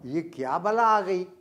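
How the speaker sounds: ordinary delivery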